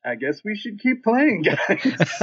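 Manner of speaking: high laughing speech. Real, not acted. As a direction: laugh through the words. Laughing while retelling the scene.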